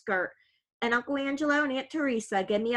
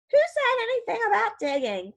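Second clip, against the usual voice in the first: much higher, mock-innocent protest; all innocence; 12 semitones higher than usual. Cousin denies digging to his uncle, feigned innocence. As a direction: play dumb, wide-eyed innocence. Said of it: "all innocence".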